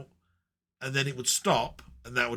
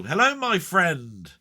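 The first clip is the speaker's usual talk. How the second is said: put-on slow, drawn-out greeting in Denny's accent, pitched up; 7 semitones higher, 9 dB louder, slower than usual. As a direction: greet him warmly the way Denny would, in his accent. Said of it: "I can hear his vo-, accent".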